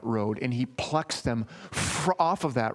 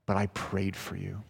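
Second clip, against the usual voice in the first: low, soft assurance; quiet reassuring voice; tender Jesus; 5 semitones lower, 5 dB quieter than usual. Acted. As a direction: comfort him gently. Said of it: "And Jesus said".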